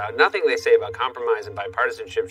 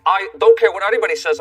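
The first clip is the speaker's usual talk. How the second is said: put-on higher, louder cranky old man; mock Bernie as a stubborn grandpa; 5 semitones higher, 5 dB louder than usual. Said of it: "Grandpa, at least put on some pants".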